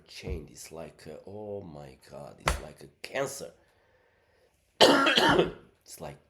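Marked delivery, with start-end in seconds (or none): 4.8-5.8 loud, sudden sick cough; real cough. Real, not acted. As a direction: cough hard, mid-talk.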